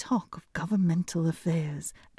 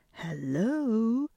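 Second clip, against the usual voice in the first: higher rising hello; cry out; 4 semitones higher than usual. A narrator's reading. Hans calls to his brothers, bright cry. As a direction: call ahead to the brothers. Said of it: "cried Clumsy Hans".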